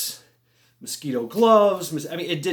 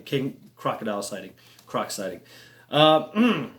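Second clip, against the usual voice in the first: normal talking voice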